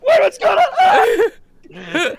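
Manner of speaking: high startled yelps; surprised shout. Real, not acted. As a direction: shriek in surprise. Sudden loud surprise.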